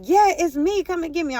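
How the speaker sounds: high, urgent silent cry acted out; retold inner plea. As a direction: pitch it up, begging to be noticed. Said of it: "I'm like, inside, I'm like".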